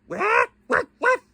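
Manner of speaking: high squawky parrot voice; parrot squawk. Pretend.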